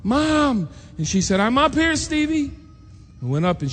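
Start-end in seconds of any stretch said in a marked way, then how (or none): 0.0-0.7 put-on a child shouting high up the stairs for his mom; hollering. Boy running into the house, hollering for his mom. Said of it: "and I'm hollering". 1.4-2.5 calling out. Retold or imitated. Mom calls down from upstairs.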